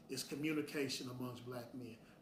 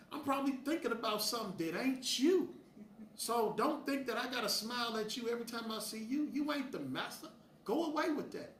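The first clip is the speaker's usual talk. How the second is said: heated; 7 semitones higher than usual. Real, not acted. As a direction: snap back, fired up. Heated retort to critics.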